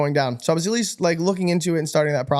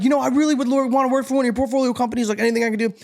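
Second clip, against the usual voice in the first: mock eager pitch; 7 semitones higher, faster than usual. Hypothetical eager ask, not said.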